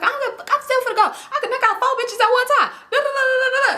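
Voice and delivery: very high-pitched, hammed-up bragging; rowdy homegirl. Homegirl boasting she'll fight four, high rowdy imitation. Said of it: "We just rowdy".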